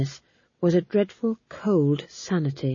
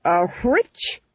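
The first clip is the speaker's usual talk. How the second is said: icy incredulous question, rising at the end; haughty Duchess's icy query. A narrator's reading. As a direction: throw it back with cold disdain.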